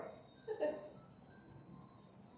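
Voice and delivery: high breathy giggle; laugh. Real, not acted. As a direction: giggle at the slip. Laughs at own mistake.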